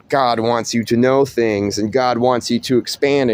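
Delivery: usual speaking voice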